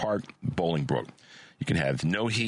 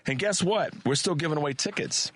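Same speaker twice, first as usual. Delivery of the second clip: excited; 8 semitones higher than usual. Real, not acted. Host teasing a ticket giveaway.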